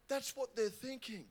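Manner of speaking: ordinary talk, nothing marked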